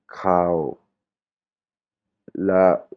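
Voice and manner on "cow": "Cow" held near speaking pitch; normal tone. Shown as a demonstration. Teacher contrasts meanings of similar words, normal tone on "Cow". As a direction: say "Cow" in a mid, unforced tone. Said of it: "la intonation normale".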